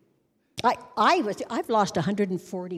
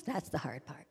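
whispered aside; 6 semitones lower, 13 dB quieter than usual. Real, not acted. Whispered aside between sung lines.

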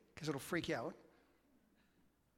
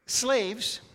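Slaves hit high and loud with a falling end; punched answer; 7 semitones higher, 8 dB louder than usual. Real, not acted. Preacher answering the congregation's question with a punched Slaves. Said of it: "You know why you get shoes".

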